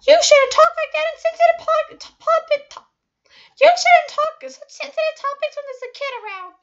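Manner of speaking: very high, loud mock shriek; screaming mother. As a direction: shriek it like a furious mom. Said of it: "she screamed at me".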